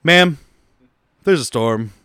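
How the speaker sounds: imitated unimpressed pilot, mostly low; sharp address, then a low, matter-of-fact refusal; deadpan delivery. Podcaster mimics pilot refusing an excited passenger, deadpan. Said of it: "his delivery is just".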